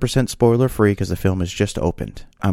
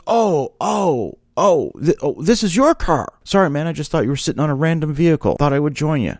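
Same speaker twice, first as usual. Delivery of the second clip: higher-pitched imitation of embarrassed surprise; flustered realization; 6 semitones higher than usual. Stranger learns whose car it is, imitated flustered high apology. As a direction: stumble through dawning realization into apology.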